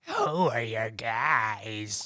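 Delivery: very slow, eerie demon voice; haunting voice. Acted. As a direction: stretch it out like a possessed stranger. Possessed voice after advice.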